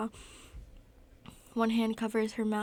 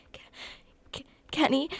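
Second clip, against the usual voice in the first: strained, stuttering higher cry; choked out; 5 semitones higher, 4 dB louder, faster than usual. A narrator's reading. Girl in an asthma attack calls her rescuer, choked. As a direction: force a name out through lack of breath. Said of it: "choke out his name".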